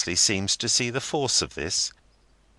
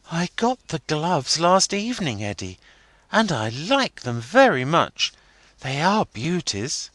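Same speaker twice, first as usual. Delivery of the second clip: put-on young girl Rosa; 10 semitones higher, 4 dB louder than usual. A narrator's reading. Rosa voiced in story.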